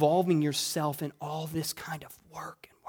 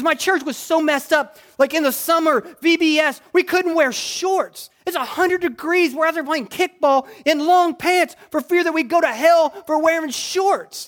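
high, heated mock rant; retold angry complaint, pitched up; imitated anger; 9 semitones higher, 7 dB louder than usual. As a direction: act out an aggrieved man venting about church. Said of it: "just so angry at church".

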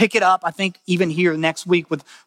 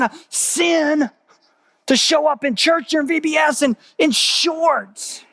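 exasperated shout; 10 semitones higher than usual. Retold or imitated. Friend retelling church rules, shouting.

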